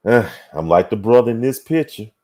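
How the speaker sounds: usual speaking voice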